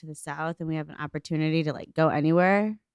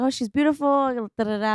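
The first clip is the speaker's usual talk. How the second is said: sweetly gushing; 7 semitones higher, faster than usual. Retold or imitated. Sweet-talking the waiter retold.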